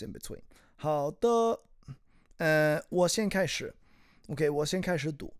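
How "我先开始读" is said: normal talking voice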